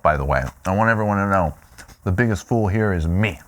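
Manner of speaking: normal talking voice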